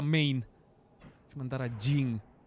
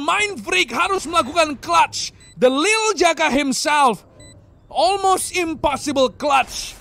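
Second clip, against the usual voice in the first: slow, emphatic, higher; dramatic announcer; 11 semitones higher, 5 dB louder, slower than usual. Acted. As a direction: savour each word of the clutch. Slow dramatic clutch call.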